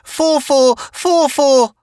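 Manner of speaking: high, loud desperate scream; boy screaming for his robot. Acted. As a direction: scream the robot's name for help. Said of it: "screaming, screaming".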